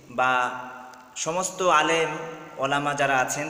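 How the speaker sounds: ordinary delivery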